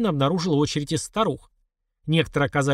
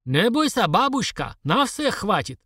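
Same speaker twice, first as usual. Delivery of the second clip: put-on higher, reassuring Caucasian-accented vendor; 6 semitones higher, slower than usual. A narrator's reading. Vendor reassuring grandma in the queue, Caucasian accent. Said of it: "хрипловатый кавказский баритон".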